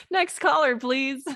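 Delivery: high, loud sing-out of a call-in host; mock radio show host. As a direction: play a radio host calling up the next caller, amused. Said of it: "feel like I'm on a radio show".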